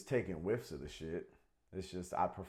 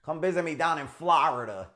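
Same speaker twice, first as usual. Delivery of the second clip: loud, higher mock Italian-American New Englander; hammed up, as a loud Boston-Italian; a loud, hammed-up Italian-American New England accent; 7 semitones higher, 10 dB louder than usual. Shown as a demonstration. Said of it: "sounded like an Italian".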